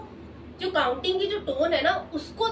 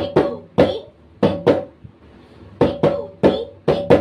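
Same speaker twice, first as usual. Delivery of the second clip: एक, दो, तीन chanted loud with a pause; rhythmic loud count; 7 semitones higher, 11 dB louder, slower than usual. Shown as a demonstration. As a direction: call out the beat. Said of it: "आधे second की देरी पर है".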